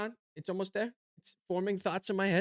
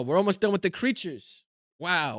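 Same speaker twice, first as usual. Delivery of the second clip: ordinary talk, nothing marked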